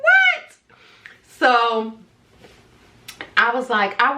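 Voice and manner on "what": high, loud startled what; he just proposed while still married; shocked what. Retold or imitated.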